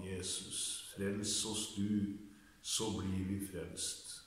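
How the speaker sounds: low, soft praying voice; prayer. Real, not acted. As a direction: pray quietly over the word, low and subdued.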